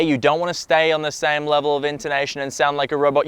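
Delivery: quick, level delivery with no change of intonation; robotic monotone. Shown as a demonstration. Coach warning against one level of intonation, robotic monotone. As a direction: rattle it off on one note like a robot.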